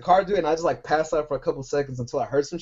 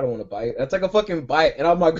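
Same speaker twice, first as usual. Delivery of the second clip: usual speaking voice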